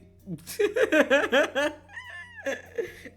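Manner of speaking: loud, high burst of laughter; real laughter. Real, not acted. Cracks up at own remark.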